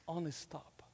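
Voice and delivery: whispered, almost no voice. Real, not acted. Pastor whispering an aside to the congregation. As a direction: breathe it out as a whisper.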